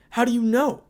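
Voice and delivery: high, loud alarmed question; frightened. Acted. Miki confronting her son, frightened high cry. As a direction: blurt it out in panic. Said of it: "Both were frightened".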